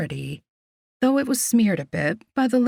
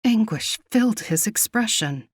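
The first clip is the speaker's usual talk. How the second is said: plain reading voice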